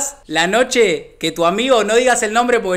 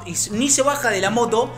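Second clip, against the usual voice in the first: usual speaking voice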